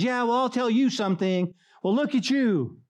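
high-pitched mock retort; lashing out. Believer hitting back at a mocker, mock indignation. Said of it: "We wanna fight back with words".

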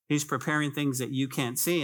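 usual speaking voice

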